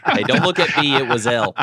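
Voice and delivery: loud, high, blame-dodging protest; mock defensive video guy. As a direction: throw the blame off yourself.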